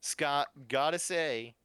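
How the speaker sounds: smug host, relishing the drama; Chris host voice. Acted. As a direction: announce the elimination like a gleeful TV host.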